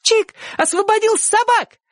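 put-on very high, defiant child's cry; fearless shout. A narrator's reading. Sonka dragged indoors by mother, shouting fearlessly. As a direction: proclaim it boldly, pitched very high. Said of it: "ещё раз бесстрашно крикнула Сонька".

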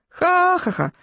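high, performed crowd laugh; long first ha, then quick ones; guffaw. A narrator's reading. Boys laugh at the fallen bully, high guffaw. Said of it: "захохотали мальчишки".

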